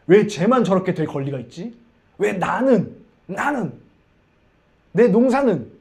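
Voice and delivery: loud, indignant outburst of resentment; jealous anger. Acted. Cain resenting his brother's blessing, angry. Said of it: "막 화가 나기 시작하고".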